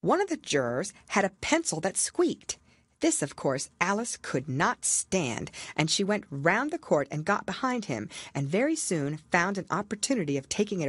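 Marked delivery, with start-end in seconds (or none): none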